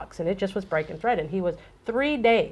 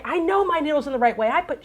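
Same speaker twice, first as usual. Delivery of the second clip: high-pitched mock insistence; defensive customer; 6 semitones higher than usual. Mimicking defensive customer she warns against.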